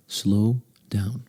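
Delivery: slow down, stretching both words; demonstratively slow. Shown as a demonstration. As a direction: draw out each word with a pause between. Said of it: "ignore what I just did".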